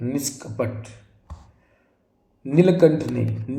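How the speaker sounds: ordinary talk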